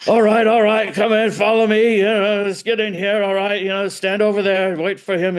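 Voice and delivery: herd the visitor into place; brusque bodyguard. Retold or imitated. Bodyguard escorting the visitor onto the stage, brisk and commanding.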